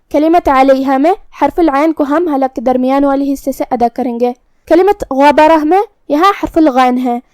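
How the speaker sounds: ordinary delivery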